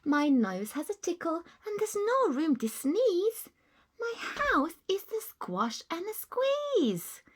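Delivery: put-on high, whispered complaining old lady. Old lady grumbling about no room, high and whiny. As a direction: whine about the cramped house.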